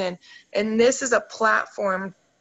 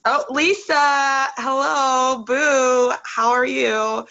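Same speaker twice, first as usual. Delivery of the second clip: bright, higher, louder hello to a friend who just joined; light up and greet her like a long-lost friend; excited greeting; 4 semitones higher, 5 dB louder, much slower than usual. Real, not acted. Host greeting Lisa as she joins the live, excited and higher.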